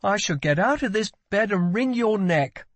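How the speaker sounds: high-pitched outraged prince; indignant royal threat; angry threat. A narrator's reading. Prince threatens his brother.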